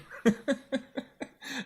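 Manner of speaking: real high-pitched delighted laugh; gleeful high laugh. Real, not acted. Laughing at roommate getting eaten in the game, high glee.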